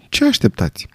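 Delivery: Vera's sudden, sharp question pitched high; abrupt question. A narrator's reading. Vera abruptly asking the old general what he awaits. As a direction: snap the question out, startled. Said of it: "întrebă Vera brusc".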